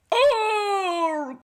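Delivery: high, sudden shriek of fright; startled walrus yelp. Acted. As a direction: yelp like a walrus caught off guard.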